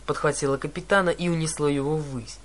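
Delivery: plain reading voice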